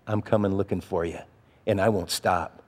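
low, quiet, controlled warning; calmly laying down a threat. Retold or imitated. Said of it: "just talking very calmly".

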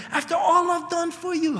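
high, aggrieved reproach; bitter spouse. Retold or imitated. An aggrieved spouse throwing up sacrifices in an argument, high reproach. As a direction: voice the resentful partner in a fight. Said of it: "you've been in those arguments".

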